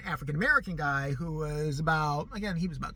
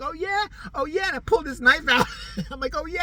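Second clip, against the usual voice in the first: mock taunt; 8 semitones higher, 4 dB louder than usual. Teller taunts before fake stabbing.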